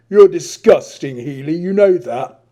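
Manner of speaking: snarl. Acted. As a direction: spit it at his face. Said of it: "snarled into Adrian's face".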